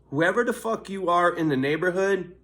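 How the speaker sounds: ordinary talk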